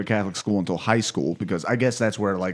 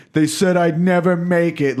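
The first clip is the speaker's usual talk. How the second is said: mock dramatic; 7 semitones higher, 4 dB louder, slower than usual.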